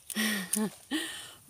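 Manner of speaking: giggle. Acted. Flirty doctor teasing patient.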